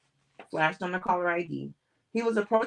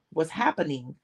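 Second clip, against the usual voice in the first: ordinary delivery, nothing marked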